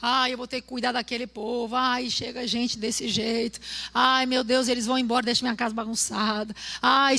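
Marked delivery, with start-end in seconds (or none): none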